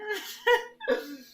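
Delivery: high burst of laughter. Real, not acted. Laughing at own admission.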